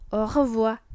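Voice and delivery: "au revoir" as "o-rə-vwar", r in the throat; French guttural R. Shown as a demonstration. Teacher modelling a farewell, "au revoir" with guttural r. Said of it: "Dengan R yang cadel".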